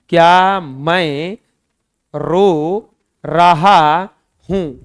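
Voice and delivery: क्या मैं रो रहा हूं drawn out very slowly, क्या rising; slow demo with क्या. Shown as a demonstration. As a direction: stretch each word, lift क्या. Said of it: "ऐसे आएगा". (बहुत धीरे, क्या पर उठान)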